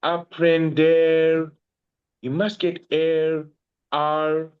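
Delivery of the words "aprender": "Aprender" stretched out slowly, each syllable clear; slow teaching demo. Shown as a demonstration. Tutor spells the verb out for the student, drawn out. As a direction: lay out "Aprender" slowly so it can be copied. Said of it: "Make sure it's down. E R, bro".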